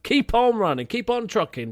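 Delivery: high, loud urging; cheering on. Real, not acted. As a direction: egg the runner on. Urging his player on.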